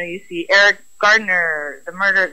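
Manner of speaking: normal talking voice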